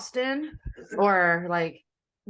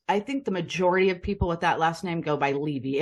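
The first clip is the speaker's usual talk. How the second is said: normal talking voice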